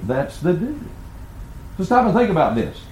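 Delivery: ordinary delivery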